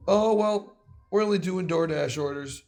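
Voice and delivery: mimicking the worker. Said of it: "It's like".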